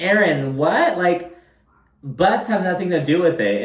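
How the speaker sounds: mock outrage at a naive friend; exasperated disbelief.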